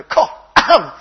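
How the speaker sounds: loud, exaggerated fake cough to a baby; hammed-up cough for the baby's game; playful mock cough. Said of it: "And I go".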